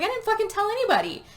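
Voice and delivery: high-pitched retold protest; mock defensive denial. Tyler denies telling anybody, high defensive retelling.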